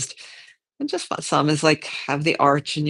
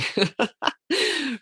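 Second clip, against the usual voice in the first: brief, higher-pitched laugh; light laugh; 10 semitones higher than usual. Real, not acted. Teacher amusing herself mid-instruction, light laugh. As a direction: let a little laugh slip out. Chuckles amid her own teaching line.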